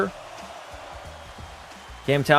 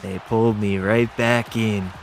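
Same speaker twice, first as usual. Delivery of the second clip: Godfather quote; 3 semitones lower, slower than usual. Retold or imitated. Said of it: "The Sopranos".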